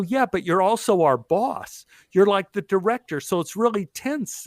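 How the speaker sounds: high-pitched retold objection; retold protest. Retells own protest to the director.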